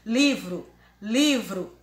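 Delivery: "Livro" said through the mouth only; non-nasal sound. Shown as a demonstration. Teacher shows a word without tilde, oral "Livro". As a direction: keep "Livro" out of the nose. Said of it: "você não vai sentir nada no nariz".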